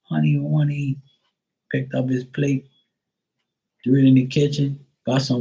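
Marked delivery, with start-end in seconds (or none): 0.0-1.0 a hint of a sarcastic teen; mock sweet reply. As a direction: imitate the nephew's sarcastic sweetness. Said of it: "And then he goes".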